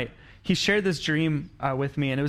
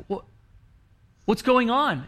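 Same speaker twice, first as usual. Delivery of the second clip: retold alarm, higher pitched; mild mock panic; 7 semitones higher, 4 dB louder than usual. Retelling his friend's dream of people in the water, mild panic. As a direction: lift the voice as if starting to panic. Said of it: "And he started panicking".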